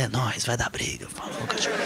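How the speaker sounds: low, soft muttered glee at a coming fight; muttered aside. Retold or imitated. Comedian to himself watching a family fight, low pleased mutter.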